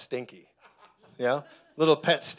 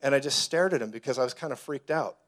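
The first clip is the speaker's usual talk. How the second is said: ordinary talk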